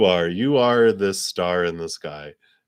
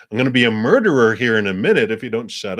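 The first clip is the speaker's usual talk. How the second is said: louder, fast, half-joking exasperated threat; mock threat; 3 semitones higher, 8 dB louder, much faster than usual. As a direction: snap back like a fed-up friend. Friend snapping at repeated question.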